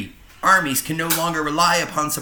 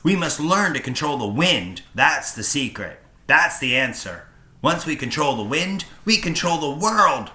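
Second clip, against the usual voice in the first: louder, higher, with mild rising zeal; renewed enthusiasm; 5 semitones higher, 6 dB louder than usual. Acted. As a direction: preach the future of flight with fire. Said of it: "regaining his enthusiasm".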